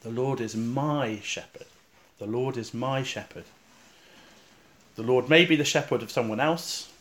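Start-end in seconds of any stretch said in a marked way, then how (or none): 0.0-1.7 "my" stretched out, slow and deliberate; stress on my. Shown as a demonstration. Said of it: "place the emphasis on the word". 2.2-3.4 "my" lengthened above the other words; stress on my. Shown as a demonstration. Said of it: "place the emphasis on the word".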